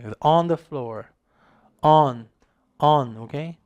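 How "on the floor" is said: "on" in "on the floor" drawn out as "ahn"; correct vowel. Shown as a demonstration. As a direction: model a slow, open "ahn the floor" for "on the floor". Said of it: "이런 느낌이 강해요". ("on"을 '아안'처럼 길게 열어서 발음)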